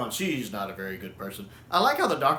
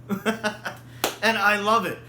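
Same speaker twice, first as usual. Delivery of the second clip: laughing; 8 semitones higher, 3 dB louder, much slower than usual. Real, not acted.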